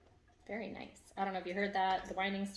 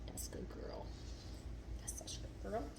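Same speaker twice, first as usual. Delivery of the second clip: whispered praise; 9 dB quieter than usual. Real, not acted. Owner calmly rewarding her settled dog, whispered praise. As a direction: whisper the praise so she stays calm.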